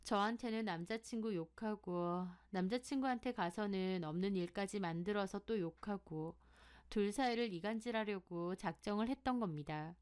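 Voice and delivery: plain reading voice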